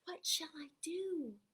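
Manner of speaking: slow unvoiced whisper. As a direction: whisper it slowly and anxiously. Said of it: "he whispered to a squirrel".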